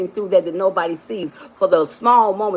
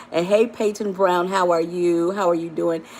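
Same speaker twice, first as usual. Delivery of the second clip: usual speaking voice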